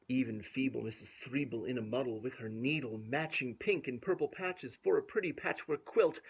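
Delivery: put-on lilting read-aloud rhythm for a child; rhymed story reading. A narrator's reading. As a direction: bounce through the rhyming verse. Rhyming verse continues.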